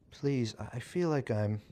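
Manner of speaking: a touch of nerves, breaking off; nervous and shaky. A narrator's reading. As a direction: falter before the bad news. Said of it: "she drew in a shaky breath".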